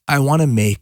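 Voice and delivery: usual speaking voice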